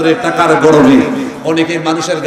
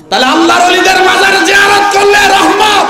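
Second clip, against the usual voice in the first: high, loud proclamation; full-force preaching; 11 semitones higher, 6 dB louder than usual. Real, not acted. Preacher proclaiming the blessing of visiting shrines, full-force shout. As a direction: cry it out to the whole gathering.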